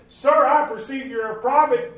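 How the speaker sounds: the woman's words, high and loud; loud preached quote. Retold or imitated. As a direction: proclaim her words from the pulpit.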